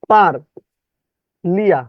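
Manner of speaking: পার, then লিয়া, as isolated chunks; distorted spelling pronunciation. Shown as a demonstration. Teacher repeating the memory trick, chopped distorted pronunciation. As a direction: dictate পার... লিয়া with a long gap. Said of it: "বিকৃত ভাবে উচ্চারণ করবেন".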